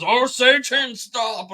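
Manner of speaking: high, loud hammed-up command; mock exorcist. Priest ordering Satan to stop, hammed-up. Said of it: "And he goes".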